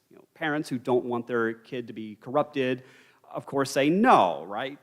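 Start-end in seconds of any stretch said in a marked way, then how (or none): 3.9-4.4 NO punched out louder; a firm, stressed "No"; emphatic refusal. Acted. Parents refuse their son, with a loud stress on NO.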